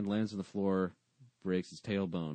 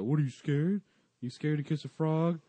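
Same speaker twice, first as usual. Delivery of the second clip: put-on high, louder kid's dare; mock taunting; 7 semitones higher, 4 dB louder than usual. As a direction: tease like kids daring someone. Kids taunt him to kiss frog.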